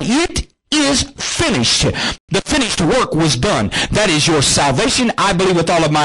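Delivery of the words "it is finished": high, slow, drawn-out cry; Jesus crying out. Retold or imitated. Preacher voicing Jesus' last words, drawn-out high cry. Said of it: "when Jesus cried".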